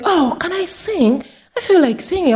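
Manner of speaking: high-pitched hopeful request; eager old lady. Retold or imitated. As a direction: lightly act an old lady keen to sing. Old resident eagerly asking to sing.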